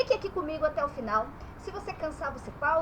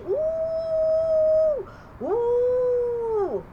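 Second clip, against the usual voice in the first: long, high "uuh" of a mocking fan; drawn-out high, loud booing; jeering crowd boo; 10 semitones higher, 5 dB louder than usual. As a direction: hoot at the losing team. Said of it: "tirando sarro da outra torcida quando perde".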